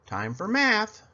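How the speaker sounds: ordinary delivery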